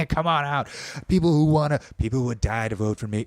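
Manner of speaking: lightly voiced Trump calling out; mock Trump rally call. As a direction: egg the crowd on like a brash showman. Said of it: "it sounds like Trump's just like".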